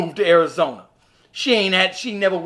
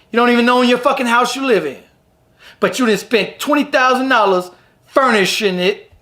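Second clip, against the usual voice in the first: ordinary talk, nothing marked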